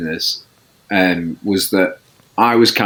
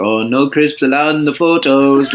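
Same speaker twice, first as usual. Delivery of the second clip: put-on light Norwegian accent, a bit higher; Ole Gunnar Solskjaer Norwegian accent; 4 semitones higher than usual. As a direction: say it like a Norwegian footballer. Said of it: "Norwegian accent going like".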